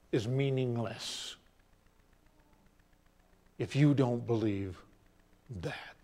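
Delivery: solemn teaching. Retold or imitated. Rabbi's solemn lesson to disciples.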